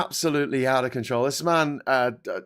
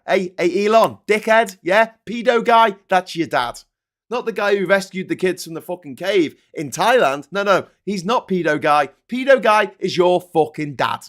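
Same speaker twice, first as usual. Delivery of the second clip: loud taunting call-out; louder jeering insult; 4 semitones higher, 6 dB louder than usual. Real, not acted. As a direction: yell it straight at him. Shouting insults at Musk.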